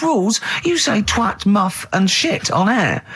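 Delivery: high-pitched mock indignation; scornful retort. [name] answering the complaint about rules, mock scorn.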